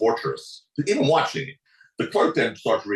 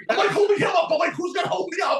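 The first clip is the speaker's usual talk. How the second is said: much higher, pleading voice; mock panic; 3 dB louder than usual. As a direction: squeak it out in mock despair. Retold panic, much higher pitch.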